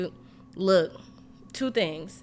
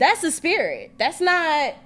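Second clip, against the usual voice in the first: emphatic; 8 semitones higher, slower than usual. Retold or imitated. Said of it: "I was basically telling her".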